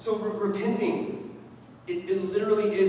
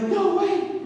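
much higher, louder cry of disbelief; 8 dB louder than usual. Retold or imitated. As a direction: blurt it out amazed. Couple reacts in disbelief.

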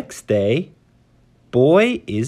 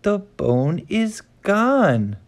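put-on boy voice; 8 semitones higher than usual.

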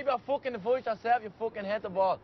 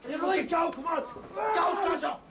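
urgent cheering shout; 8 semitones higher than usual. Acted. Mate yelling encouragement during the brawl. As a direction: shout him on to go.

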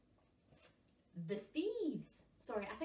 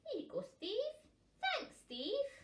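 high, bright friendly voice; friendly puffin; 12 semitones higher, 5 dB louder, slower than usual. Acted. Puffins sharing after making up, friendly. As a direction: hand it over kindly.

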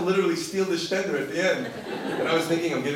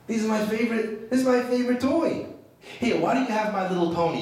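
lightly voiced little girl, higher pitch; a hint of a child pleading for a favorite toy; little girl protesting; 7 semitones higher than usual. Child pleading to keep her pearls.

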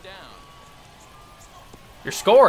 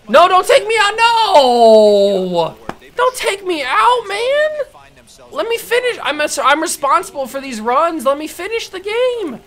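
high-pitched frantic yelling, begging loud; desperate shriek; 13 semitones higher, 7 dB louder than usual. Real, not acted. Gamer begging the coach to leave him in, shrieking. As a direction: shriek at the coach not to pull you.

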